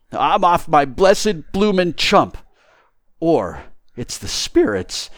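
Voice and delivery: rattled tramp talking himself round; bewildered tramp. Acted. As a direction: lament your own addled head, slowly.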